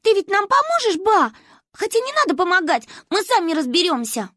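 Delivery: put-on high, loud little girl; high-pitched childish agitation; excited child. A narrator's reading. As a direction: plead eagerly, then declare independence. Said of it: "У Маньки раскраснелись от волнения щёки".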